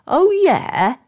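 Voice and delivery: high, challenging comeback; ready for a fight. Acted. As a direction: square up to them with a question. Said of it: "in an I'm ready for a fight sort of way".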